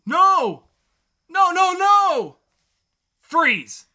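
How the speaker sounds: overacted cop screaming freeze; hammed-up shouting. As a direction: scream at him to stop. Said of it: "dramatic reading".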